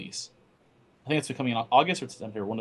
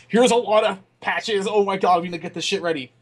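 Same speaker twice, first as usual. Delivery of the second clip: panicked developer voice; 6 semitones higher, 4 dB louder than usual. Retold or imitated. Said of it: "just be like".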